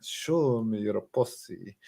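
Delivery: put-on slow, drawn-out Spanish accent. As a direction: stretch "posse" out in a Spanish accent. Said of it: "it should be a Spanish".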